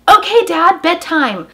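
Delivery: put-on high, loud chirpy kids' reply; girls obeying dad. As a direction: mimic eager little girls agreeing.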